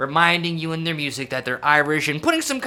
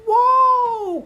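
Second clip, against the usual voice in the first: long, high, loud "whoa"; one held, soaring high "whoa"; euphoric whoa; 6 dB louder than usual. Retold or imitated. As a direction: imitate a euphoric musical lift. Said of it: "like, a euphoric".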